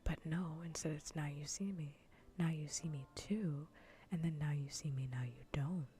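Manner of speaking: ordinary talk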